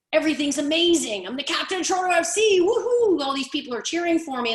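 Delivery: high, loud, crowing with excitement; elated star player. Acted. Coach plays player before a cheering crowd, elated. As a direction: revel in the cheers, voice climbing to a whoop.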